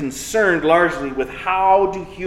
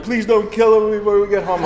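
put-on higher, pleading kid voice; mock tearful begging; imitating crying kids; 4 semitones higher than usual. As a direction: plead like an upset child, lightly acted. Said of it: "the kids are crying".

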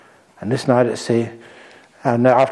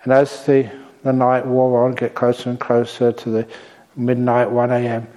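ordinary talk, nothing marked

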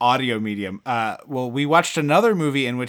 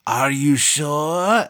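very slow, drawn-out witch question; old witch impression; much slower than usual. Witch testing Lance's resolve, slow character voice. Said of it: "and she's like".

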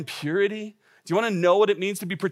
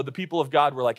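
usual speaking voice

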